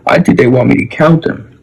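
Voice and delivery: put-on sleepy child bunny. A narrator's reading. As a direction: speak like a drowsy little bunny.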